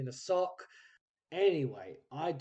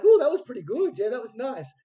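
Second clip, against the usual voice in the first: much higher and louder, gushing; delighted praise; 9 semitones higher, 13 dB louder than usual. Retold or imitated. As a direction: gush over the take like it went great. Retelling praise after first take.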